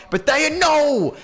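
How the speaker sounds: very high, loud, drawn-out cry; dismayed shout. Real, not acted. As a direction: yell as the shot goes wrong. Teammate misses open shot.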